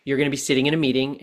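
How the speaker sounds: ordinary talk, nothing marked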